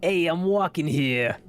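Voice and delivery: a bad New York accent, Midnight Cowboy style. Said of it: "I can't do the New York accent".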